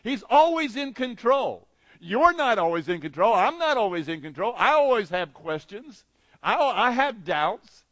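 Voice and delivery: loud, ringing preacher's voice; preaching at full force. Acted. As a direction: preach it out to the congregation.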